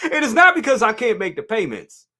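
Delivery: laughing through the words. Real, not acted. Host joking to chat about being broke, laughing. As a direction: giggle out the punchline.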